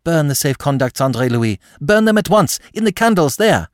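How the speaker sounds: put-on high, urgent young woman's order; sharp. A narrator's reading. Aline urges André Louis from the background, sharply. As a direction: urge him sharply, pitch climbing. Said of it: "spoke sharply".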